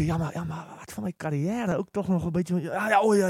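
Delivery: ordinary talk, nothing marked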